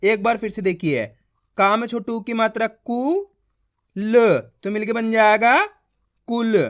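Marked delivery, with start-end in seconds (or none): none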